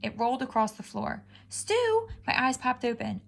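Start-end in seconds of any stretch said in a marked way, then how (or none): 1.5-2.1 high, eager question; perked-up cat. A narrator's reading. Cat hearing stew mentioned, high eager perk-up. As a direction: ears pricking at the word.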